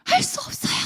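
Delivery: high, strained student yelling back; loud retort. Retold or imitated. Student shouting back at his teacher in a high strained voice. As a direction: fling it back like a defiant kid. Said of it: "크게 이야기를 했어요".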